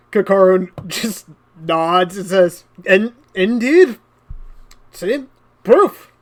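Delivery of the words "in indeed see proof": smug. Acted. Captain smug.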